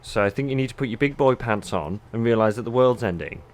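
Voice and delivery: ordinary delivery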